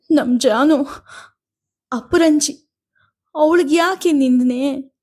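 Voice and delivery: high, slow, sobbing lament for her daughter. A narrator's reading. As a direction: cry the words out, breaking. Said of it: "ಎನ್ನುತ್ತಾ ಬಿಕ್ಕಿದ".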